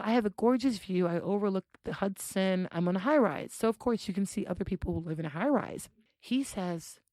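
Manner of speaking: ordinary delivery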